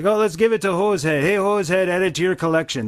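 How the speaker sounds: high, fast hammed-up hoser; goofy brother calling the dog; Bob and Doug voice. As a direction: toss it off cheerfully to the dog.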